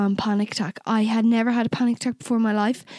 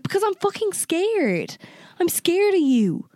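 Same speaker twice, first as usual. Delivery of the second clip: retold plea, pitched high and stretched out; distressed outburst; 8 semitones higher than usual. Telling him why she won't get in the car, distressed. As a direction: blurt it high, acting out distress.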